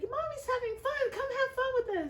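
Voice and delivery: put-on very high, loud children's call; high children's voices calling out with glee; excited kids. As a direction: shout like little kids inviting mom to play.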